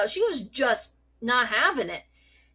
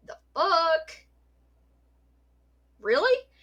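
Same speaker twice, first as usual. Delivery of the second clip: mock disbelief; 6 semitones higher, slower than usual. Shocked at cat loving fiance.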